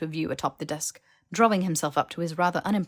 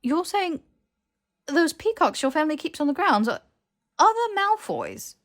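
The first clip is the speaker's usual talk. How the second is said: aghast disbelief; 11 semitones higher, 6 dB louder than usual. Acted. Harry realizing the peacocks are Malfoys, aghast. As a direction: voice climbing in horrified disbelief.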